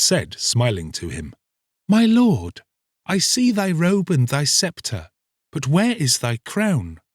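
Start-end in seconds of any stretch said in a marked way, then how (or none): 1.9-7.0 put-on high, childlike page voice; wondering little page. A narrator's reading. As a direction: ask in innocent wonder. Little page asks, smiling.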